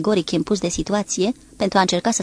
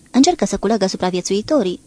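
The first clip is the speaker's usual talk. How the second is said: plain reading voice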